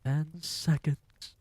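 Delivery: lightly voiced slow witch count; Grand High Witch. A narrator's reading. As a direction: stretch the count out gloatingly. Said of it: "cried the Grand High Witch".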